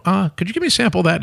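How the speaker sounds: high and fast, eager to try it; mock-innocent high request to a friend; eager ask. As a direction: chirp the request up high like a kid wanting candy. Retold asking a friend for samples.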